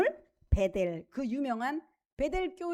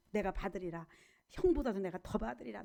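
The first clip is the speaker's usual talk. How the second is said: low, soft, grimly determined vow; a hungry younger brother vowing under his breath, lower and softer; determined Jacob; 7 semitones lower, 8 dB quieter than usual. Acted. Preacher voicing Jacob muttering his low, soft vow. Jacob vows to seize blessing.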